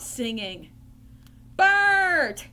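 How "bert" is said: loud, high-pitched shout for help; yell. Acted. Ernie calls Bert in from the bath, yelling. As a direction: holler the name to summon him. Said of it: "yelled Ernie".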